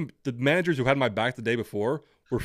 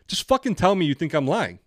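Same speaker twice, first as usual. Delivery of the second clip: worked-up, higher and louder at first; exasperated retort; 4 semitones higher, 5 dB louder than usual. Retold or imitated. Applicant retorting to a doubting interviewer, exasperated. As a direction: vent it like an indignant comeback.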